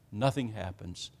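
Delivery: "Nothing happens" dropped low and soft; hushed echo. Real, not acted. Preacher echoing that Baal stayed silent, low and hushed. Repeated for emphasis.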